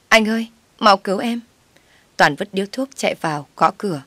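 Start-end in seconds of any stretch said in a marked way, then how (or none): 0.1-1.4 higher, louder call for help; fake cry for help. Loan in the bathroom calling out to Toàn for help. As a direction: cry out as if hurt, to lure him in. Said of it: "ngồi xuống sàn kêu lên".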